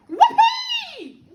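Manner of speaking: piercingly high, loud whoop; gleeful shout. A narrator's reading. Grandma finding her voice, gleeful shout. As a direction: let out a wild whoop. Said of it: "She shouted, finding her voice at last".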